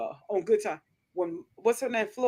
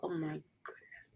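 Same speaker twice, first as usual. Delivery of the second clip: whispered aside; 5 semitones lower, 18 dB quieter, slower than usual. Real, not acted. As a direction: breathe it out in a whisper.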